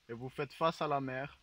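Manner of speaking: guided meditation. Acted. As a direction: lull them into calm. Leads a mock guided meditation.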